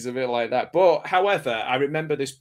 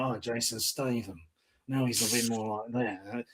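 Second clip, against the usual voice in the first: much softer, low, rough growl of a hard man; gravelly tough-guy impression; 2 semitones lower, 8 dB quieter than usual. Mocking Jason Statham's newer voice, low and gravelly. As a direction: sink into a low rasp like a movie hard man. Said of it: "now he's like".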